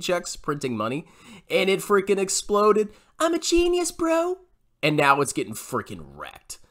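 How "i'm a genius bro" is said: high, put-on boastful voice; mocking YouTuber impression. Critic mocks a stock picker's boast, high mocking voice. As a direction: mimic a smug bro in a raised pitch.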